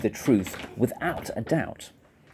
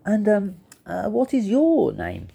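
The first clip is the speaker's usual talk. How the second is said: high, hesitant little voice; polite; 7 semitones higher than usual. A narrator's reading. Embarrassed Fluffy asks the rhinoceros's name, politely hesitant. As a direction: ask timidly, pitched up. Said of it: "he tried to be polite".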